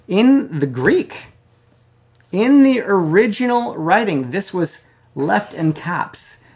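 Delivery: high, loud, slow emphatic preaching; preaching emphasis. Acted. Preacher stressing the original Greek to the church, loud and high. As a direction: lean hard on the Greek original.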